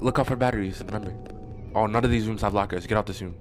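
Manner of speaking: usual speaking voice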